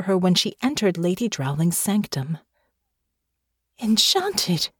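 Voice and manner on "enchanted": horrified thought. A narrator's reading. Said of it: "she thought with horror".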